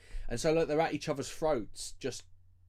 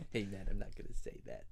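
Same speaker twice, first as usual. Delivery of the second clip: low, soft put-on voice with amusement; imitated rapper; 4 semitones lower, 5 dB quieter than usual. Podcaster reliving a favourite rapper moment, in a low, amused imitation. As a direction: quote the rapper's refusal in a low voice. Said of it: "That fucking bit with the rappers".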